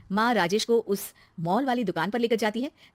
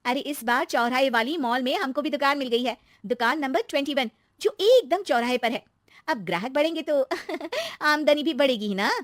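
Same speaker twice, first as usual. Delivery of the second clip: put-on higher-pitched, gleeful young wife; delighted daughter-in-law; 5 semitones higher, 3 dB louder than usual. Daughter-in-law telling aunty about the new shop, delighted. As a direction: share the news with a giggle.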